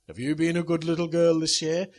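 loud, hammed-up Santa question. Dad as Santa questioning his daughter, loud and hammy. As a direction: boom it out as Father Christmas. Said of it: "I looked at Ruth and I said".

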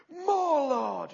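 high, loud stretched plea; pleading cry. Retold or imitated. Pleading with God for more.

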